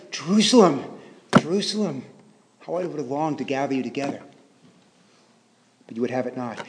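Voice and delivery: sorrowful quote fading away; understated lament. Acted. Preacher voicing Jesus looking over Jerusalem, soft understated lament. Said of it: "especially in lament".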